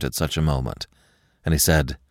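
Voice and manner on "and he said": ordinary delivery, nothing marked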